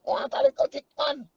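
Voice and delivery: high, teasing female MC imitation. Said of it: "and she was".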